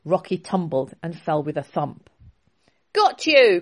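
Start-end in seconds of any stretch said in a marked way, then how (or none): 2.9-3.6 loud, high triumphant shout. Acted. Prince catching the thief, triumphant shout. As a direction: yell it as you catch him. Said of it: "Prince Dev shouted".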